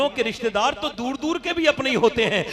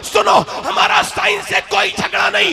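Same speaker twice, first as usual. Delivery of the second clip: higher and louder, shouting the sermon; fiery preaching; 8 semitones higher, 10 dB louder than usual. Acted. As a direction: thunder it out to the crowd. Preaching at full force.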